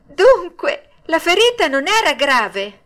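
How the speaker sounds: high, loud, eager question; excited high-pitched puppet voice; overjoyed. A narrator's reading. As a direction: ask eagerly, full of relief.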